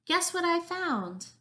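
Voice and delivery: high, loud, stretched-out call; excited shout. A narrator's reading. Jessica nears the inlet with news, shouting. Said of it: "she shouted".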